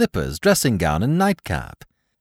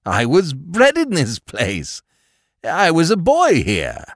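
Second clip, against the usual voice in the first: higher, louder, slow wonder; excited; 8 semitones higher, 5 dB louder than usual. Acted. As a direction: marvel at coming home. Scrooge recognizes his childhood home.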